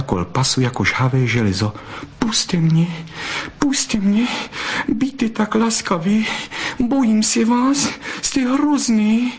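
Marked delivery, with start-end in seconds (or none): 2.2-9.3 very high, distressed vixen voice; frightened pleading. A narrator's reading. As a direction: beg him in a high-pitched panic. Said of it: "zaprosila Bystrouška". (vyděšené prosení vysokým hlasem)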